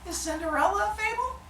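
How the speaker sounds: put-on high, soft child voice; imitated kid asking. Teacher quoting students' questions, high mock kid voice. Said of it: "'cause I have kids ask".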